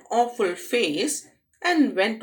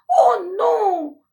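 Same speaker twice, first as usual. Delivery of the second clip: much higher, loud scared cry; frightened shout; 11 semitones higher, 8 dB louder than usual. Acted. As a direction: cry out in fright held high. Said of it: "shouted Lois".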